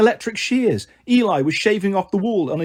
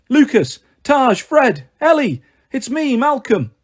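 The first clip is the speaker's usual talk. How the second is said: higher, raised voice hailing friends; calling out; 6 semitones higher, 3 dB louder, slower than usual. A narrator's reading. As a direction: call urgently to get their attention. Calling friends while running.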